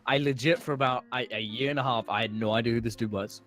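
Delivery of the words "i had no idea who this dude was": ordinary talk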